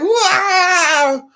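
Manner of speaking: high falsetto wail; hammed-up cry of alarm; mock startled scream. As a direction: re-enact a shocked yelp. Acting out own shock at mouse.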